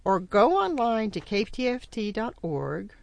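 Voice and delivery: usual speaking voice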